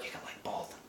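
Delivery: quick hushed joke under the breath; whispered aside. Real, not acted. Aside while defending DC.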